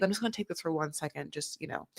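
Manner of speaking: quick, soft excuse to a partner; discreet aside. Pretend. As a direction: excuse yourself quickly under your voice. Models discreetly excusing oneself for call.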